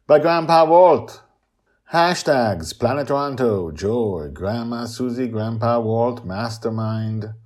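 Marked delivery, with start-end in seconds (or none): 0.1-1.2 announcer. Acted. Episode sign-off announcement.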